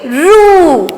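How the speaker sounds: रू with a long, held "oo"; syllable demo. Shown as a demonstration. Teacher showing how it is said, रू drawn out. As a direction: stretch the vowel of रू. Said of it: "इसको क्या बोलेंगे".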